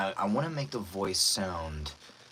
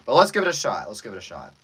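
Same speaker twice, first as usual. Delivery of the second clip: ordinary delivery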